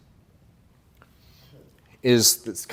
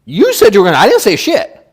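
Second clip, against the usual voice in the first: emphatic retort; 8 semitones higher, 10 dB louder than usual. Retold or imitated. Mocking the trap he set.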